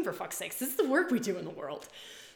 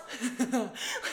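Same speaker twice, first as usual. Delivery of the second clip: bright burst of laughter; 4 semitones higher than usual. Real, not acted. To her audience, laughing at her own outburst. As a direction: laugh out at herself.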